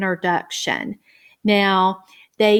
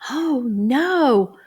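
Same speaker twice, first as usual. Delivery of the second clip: higher playful dismay; mock alarm; 7 semitones higher than usual. Shown as a demonstration. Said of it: "Turn the page".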